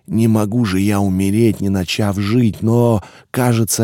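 ordinary delivery, nothing marked

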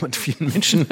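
normal talking voice

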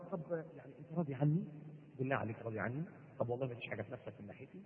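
low, very soft wheedling; hushed, imitated wifely cajoling; coaxing wife. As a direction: sweet-talk a husband at bedtime. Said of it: "أستحلب وأتدحلب عليه".

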